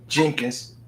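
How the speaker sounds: ordinary talk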